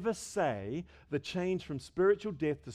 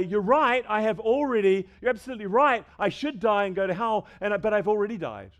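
loud, higher, emphatic comeback; acted retort to Satan; 4 semitones higher, 7 dB louder than usual. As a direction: answer the accuser forcefully, voice raised. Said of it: "you can say".

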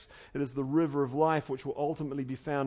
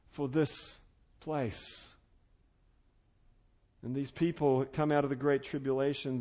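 ordinary talk

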